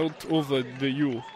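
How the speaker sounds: hesitant, failing attempt at a Russian accent; faltering Russian accent. Host retries a garbled lyric, faltering Russian accent. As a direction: fumble through a bad Russian accent. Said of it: "I can't do that".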